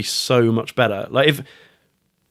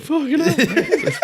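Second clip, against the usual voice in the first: much higher, drawn out and laughing; laughing exclamation; much slower than usual. Retold or imitated. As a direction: blurt it out slowly through a laugh. Retelling kids being born.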